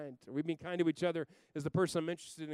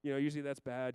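normal talking voice

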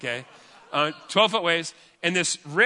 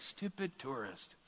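imitating a scornful lifeguard, slow and softer; contemptuous attitude; 15 dB quieter, slower than usual. Lifeguard coming out to rescue a tourist, muttered contempt. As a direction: mutter it slowly under your breath with disdain. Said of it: "comes out with this attitude like".

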